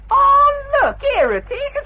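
loud high feigned oh; mock surprise. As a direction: fake sudden discovery. Loud discovery exclamation.

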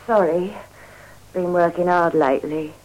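normal talking voice

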